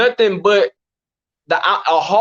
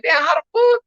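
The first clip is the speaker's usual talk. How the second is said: high-pitched retold indignation; exasperated, pitched up but not shouted; mad; 8 semitones higher than usual. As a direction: re-enact being mad in disbelief. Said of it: "I was mad".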